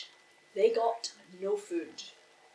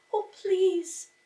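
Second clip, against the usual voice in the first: high, louder pleading; begging fish; 9 semitones higher, 5 dB louder than usual. A narrator's reading. Fish begging Omar, high and pleading. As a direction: beg with a little voice. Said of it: "the fish begged".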